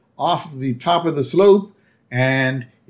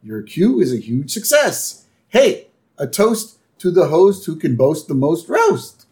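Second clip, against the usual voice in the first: higher, chirpy neighbour voice; higher Flanders impression; Ned voice; 6 semitones higher than usual. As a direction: toast him with sunny cheer. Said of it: "He goes".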